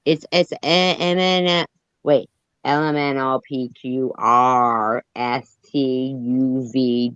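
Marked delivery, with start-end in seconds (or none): none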